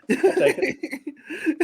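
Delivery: drawn-out, high, laughing concession; a slow, laughing, sing-song I'll take it. Real, not acted. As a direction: stretch it out slowly, high, through a laugh.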